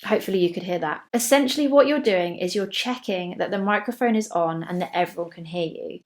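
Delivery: normal talking voice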